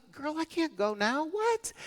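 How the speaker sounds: high, strained voice rising to a squeak on what; retelling the shock of having to go next; panicked disbelief. Retold or imitated. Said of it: "I'm like".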